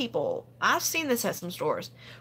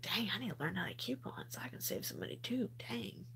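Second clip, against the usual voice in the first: mock whisper, much softer, fast; whispered cashier quote; 14 dB quieter than usual. Cashier whispering about couponing, mock hushed whisper. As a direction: hush it like a secret confession. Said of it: "a lot of cashiers are like".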